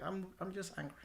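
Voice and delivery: softly stated anger, held in; quiet anger. Acted. Telling friends he is angry without destroying anything, quiet anger. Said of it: "When I'm quiet".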